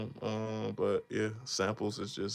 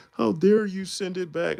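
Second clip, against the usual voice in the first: mock outrage; 10 semitones higher, 6 dB louder than usual. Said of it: "Everybody's like".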